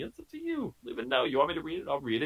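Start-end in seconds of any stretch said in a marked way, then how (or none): 0.0-0.7 up to you sung high and soft; sing-song high. Shown as a demonstration. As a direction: float it up high, teasing. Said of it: "That's not up to me".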